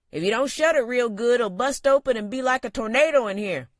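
high, loud folksy barkeep; gruff bartender. A narrator's reading. Bartender warning about the door, gruff and loud. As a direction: warn him off like a gruff old barman.

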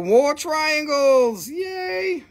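high, very slow gleeful announcement; excited. Real, not acted. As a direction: stretch it out in delight. Excited reveal of stones.

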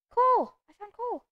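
high, loud burst of joy; excited shout. Real, not acted. Player spotting coal, excited shout. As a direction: blurt it out thrilled.

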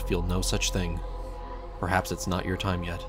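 plain reading voice

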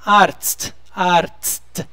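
Arzt with AR then a crisp TST; careful pronunciation demo. Shown as a demonstration. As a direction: say Arzt twice, ending clearly in T-S-T. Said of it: "Gente, separa. Vamos falar ar".

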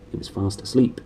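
usual speaking voice

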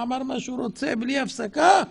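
usual speaking voice

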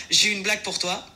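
usual speaking voice

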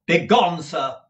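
loud, curt order; peremptory. A narrator's reading. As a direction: send him off with no room to argue. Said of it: "in his most peremptory manner".

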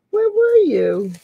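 high doggy-voice greeting; pet talk. Real, not acted. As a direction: coo it like greeting a lost puppy. Greeting her dog Boston.

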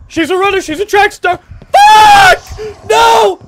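very loud, high cries of alarm; panicked screaming. Real, not acted. Gamer fleeing a fast Granny, panicked screaming. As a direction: yell in panic while being chased.

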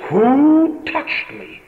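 incredulous, high-pitched and drawn-out question; astonished echo. Retold or imitated. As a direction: stretch each word, pitched high in disbelief. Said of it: "his astonished disciples say".

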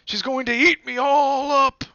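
high, loud panicked wail; high loud terrified bear wail. A narrator's reading. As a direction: wail it while running away. Bear flees in terror.

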